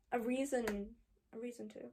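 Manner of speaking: halting, fading to very quiet; faltering confession. Acted. Confessing to someone who thought her brave, faltering and fading. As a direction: trail away softly on the repeat.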